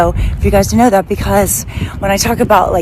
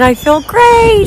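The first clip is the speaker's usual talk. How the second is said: much higher, louder and slow; elated; 13 semitones higher, 7 dB louder, much slower than usual. Real, not acted. Vlogger back from her walk, gushing with excitement. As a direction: savor it like a victory.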